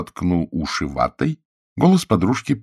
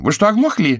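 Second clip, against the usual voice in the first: high, loud offended cry; indignant exclaim; 5 dB louder than usual. A narrator's reading. Chiara snaps at the sergeant, high and loud. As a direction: snap at the deaf policeman, loud and high. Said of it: "воскликнула она".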